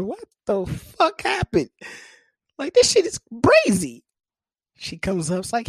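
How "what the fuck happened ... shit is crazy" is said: put-on baffled boyfriend. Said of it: "And I'm just sitting here".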